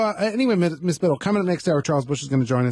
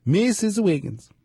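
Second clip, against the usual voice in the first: slow, drawn-out mock-Swedish accent; Mister Tudball; 3 semitones higher, slower than usual. Host imitating a character calling his secretary, mock-Swedish accent. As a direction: call the name in a hammed-up Swedish accent. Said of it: "Mister Tudball was Swedish".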